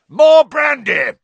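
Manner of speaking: high, loud, drawn-out shout; panicked yell. A narrator's reading. Uncle cutting off a brewing row, yelling. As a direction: bellow it to drown the argument. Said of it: "yelled Uncle Vernon".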